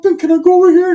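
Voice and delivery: high whiny begging; Ricky's pleading kid voice. Retold or imitated. Ricky asking his tio to come along, high whiny voice. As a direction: beg like a little kid.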